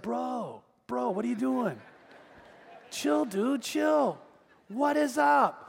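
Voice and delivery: laid-back surfer impression, drawn-out words; surfer guy. Surfer calming a man cussing at him, surfer-dude voice. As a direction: imitate a chilled-out surfer dude. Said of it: "the real surfer guy".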